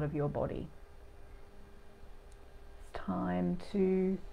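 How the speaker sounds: lingering, soothing meditation delivery; guided meditation. Real, not acted. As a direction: guide listeners gently into their bodies, unhurried.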